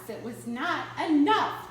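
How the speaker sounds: ordinary delivery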